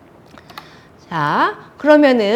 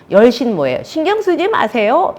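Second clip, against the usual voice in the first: raised, mock-defiant retort; 6 semitones higher, 4 dB louder than usual. Daughter answering her scolding mother, defiant. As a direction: talk back as a rebellious daughter. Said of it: "반항이 있었던 거죠".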